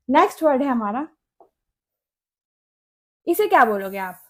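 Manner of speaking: usual speaking voice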